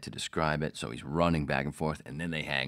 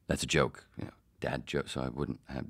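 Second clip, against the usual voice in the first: ordinary delivery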